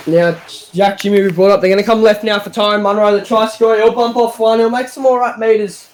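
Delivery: live sports call, steady pace; play-by-play commentary. Acted. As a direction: call the game as it unfolds.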